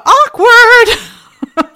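high, loud, laughing "awkward"; sing-song awkward with laughter; mock awkward squeal. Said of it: "they're like".

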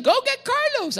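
gleefully sic him on Carlos; mock God egging Satan on. God to Satan, high teasing dare.

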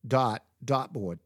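"dot, dot board" slow, r missing from dart; Bostonian dropped R. Retold or imitated. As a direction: slowly repeat "dot, dot board" without the R. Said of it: "She pronounced it dot".

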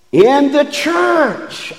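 in the church drawn out high and slow; savoured phrase. Real, not acted. Preacher relishing a scripture phrase to the congregation. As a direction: lift church up high and stretch it. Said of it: "I love that".

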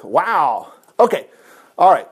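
usual speaking voice

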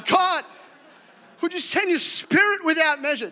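high, loud, slow churchy pray-er; mock fervent prayer. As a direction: ham up a pious prayer.

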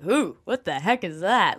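exaggerated "what on earth" reaction; onlookers' disbelief. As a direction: imitate baffled onlookers, pitch bouncing. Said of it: "people would look at Jesus and be like".

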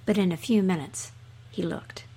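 usual speaking voice

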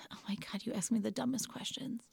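breathy hushed whisper, barely voiced; whispered reply. Daughter whispering back at her mother during labor. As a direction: whisper it like a private joke.

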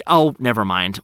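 usual speaking voice

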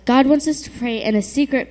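normal talking voice

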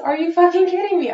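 high, loud angry yell; furious shout. Retold or imitated. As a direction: explode at him. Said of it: "And I just fucking explode".

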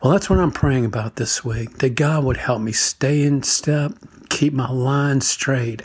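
normal talking voice

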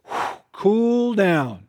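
slow exhale, "whew" drawn out then eased; calming exhale. Shown as a demonstration. Preacher showing husbands how to decompress at the door, slow sigh. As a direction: breathe out slowly and settle. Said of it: "a serious attempt as you step into the threshold".

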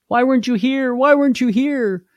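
high anguished reproach; grieving sister's plea. Acted. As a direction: voice a mourner begging the one who came too late. Grieving sisters at Lazarus' tomb.

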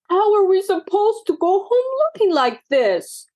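high upset wail. A narrator's reading. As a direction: wail in dismay, pitched high. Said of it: "wailed Daisy".

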